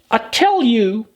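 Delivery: high, mock-stern girl's voice; Alice scolding herself. Alice scolding herself for crying, high and mock-stern. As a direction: tell herself off like a governess.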